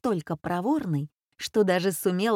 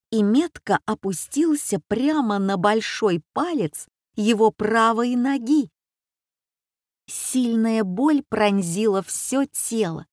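ordinary delivery, nothing marked

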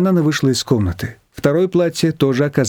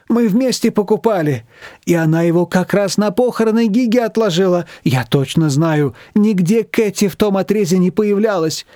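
lightly voiced worried older woman, rising to a high plea; anxious Manana; 6 semitones higher than usual. A narrator's reading. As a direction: fret and fuss, then beg for help. Манана тревожится о платье. (слегка озвученная встревоженная Манана)

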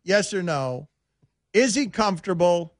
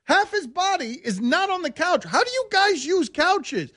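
high, whiny complaining; pitched-up whiny protest; 11 semitones higher than usual. Real, not acted. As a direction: whine about a couch that doesn't matter. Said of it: "Why do I sound this whiny".

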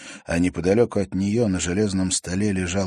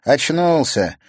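mocking girl; 8 semitones higher, 7 dB louder, slower than usual. A narrator's reading. Girl announces he woke.

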